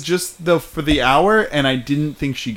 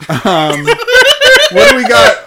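laughing; 10 dB louder, much slower than usual. Real, not acted. Laughing while asking what's next.

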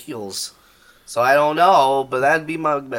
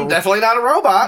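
silly robot voice; 5 semitones higher, 5 dB louder, much faster than usual. Pretend. Joining the silly voice game.

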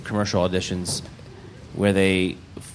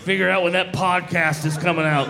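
mock impatient; 8 semitones higher than usual. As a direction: ham up desperate impatience. Mocking eager fans.

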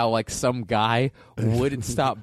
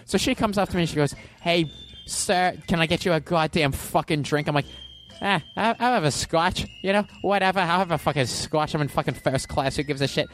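aggrieved comic storytelling, climbing higher as it goes; Bill Burr; 3 semitones higher than usual. Acted. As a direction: rant through an airplane story in mock outrage. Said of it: "this is Bill Burr's talking about".

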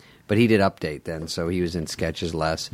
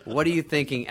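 higher-pitched mock berating; exasperated typist; 7 semitones higher than usual. Typist telling new writer his sketch bombs, exasperated. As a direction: tear into the writer as a fed-up typist.

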